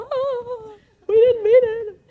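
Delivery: high, hammed-up child's wail; mock crying. Guilty children protesting to their aunt, mock bawling. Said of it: "They're crying by now".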